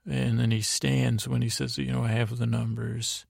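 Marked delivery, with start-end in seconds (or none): none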